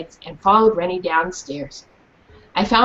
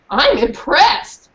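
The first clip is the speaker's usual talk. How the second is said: teasing drawl; 4 semitones higher, 4 dB louder, much slower than usual. Acted. Teen sister teasing.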